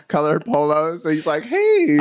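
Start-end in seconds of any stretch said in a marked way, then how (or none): 1.4-2.0 very high, louder chirpy hey; high-pitched friendly little hello; mock friendly greeting. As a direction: imitate a harmless, cheery guy saying hi. Brother's bright greeting, imitated.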